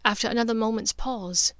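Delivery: usual speaking voice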